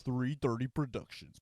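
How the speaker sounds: hammed-up studio announcer; announcer tag. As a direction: sell the company name. Announcing production company.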